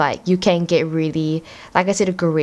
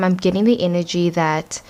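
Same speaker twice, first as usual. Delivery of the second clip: ordinary delivery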